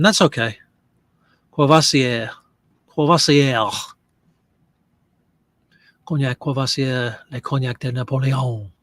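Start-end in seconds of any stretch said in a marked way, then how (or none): 1.5-3.7 Courvoisier said "kwoh-vah-see-eh" with a French lilt; mock French. Shown as a demonstration. Practising the brand name for viewers in mock French. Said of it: "don't know how to pronounce". 6.0-8.7 put-on understated French-accented label reading; mock French. Presenting the bottle to viewers in light mock French. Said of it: "reading label with flourish".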